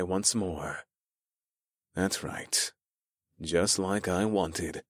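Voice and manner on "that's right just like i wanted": soft, low, slow musing; quiet inner thought. A narrator's reading. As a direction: think it quietly to yourself.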